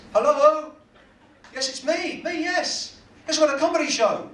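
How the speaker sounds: high, loud man on his mobile; brash, high-pitched phone chatter; loud phone answering. Acted. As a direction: take a call at full volume, oblivious to everyone around. Acted loud phone call in theatre.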